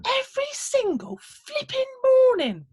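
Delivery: very high, loud, outraged squeak; indignant little man. Acted. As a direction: tell him off from down by his boots. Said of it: "said the little man".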